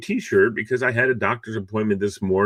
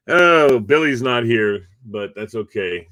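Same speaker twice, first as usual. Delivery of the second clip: usual speaking voice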